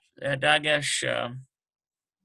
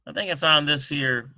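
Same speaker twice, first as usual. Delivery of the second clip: normal talking voice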